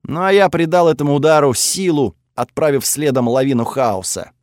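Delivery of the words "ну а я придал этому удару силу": plain reading voice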